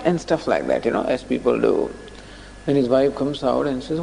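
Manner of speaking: normal talking voice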